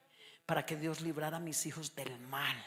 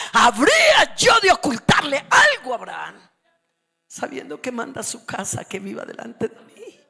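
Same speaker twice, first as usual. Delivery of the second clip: booming shouted sermon, higher; preaching at full force; 8 semitones higher, 21 dB louder than usual. Acted. Preacher shouting God's words to the congregation, booming. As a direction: thunder God's words at the church.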